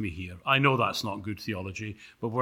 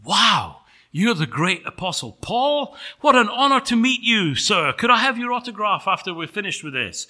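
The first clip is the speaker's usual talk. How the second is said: high, loud gushing admiration; starstruck angel; 6 semitones higher, 5 dB louder than usual. Acted. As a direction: gush at your hero, then get businesslike. Angel starstruck meeting Paul.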